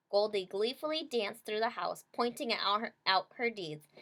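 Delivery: ordinary delivery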